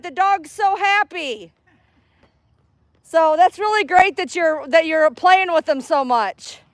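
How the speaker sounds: pitched well up, sweet and friendly; friendly stranger chat. Real, not acted. Greeting a dog owner at the park, high friendly voice. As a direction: lift the voice high to charm a passer-by.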